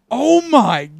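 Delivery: high, loud, slow fake horror; fake shock. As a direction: ham up innocent shock. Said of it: "he's like".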